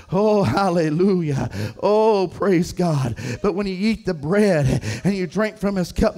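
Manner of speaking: ordinary delivery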